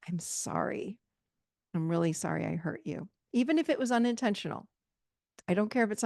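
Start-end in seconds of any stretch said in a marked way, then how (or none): 0.1-3.1 softer, quiet heartfelt sorry; sincere apology. Shown as a demonstration. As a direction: apologize gently and mean every word. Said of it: "a healthy apology looks like this or sounds like this".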